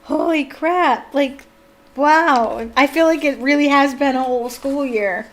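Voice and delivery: loud wondering holy crap; amazed. Real, not acted. Reader stunned the school year is over, amazed. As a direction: marvel that a year passed.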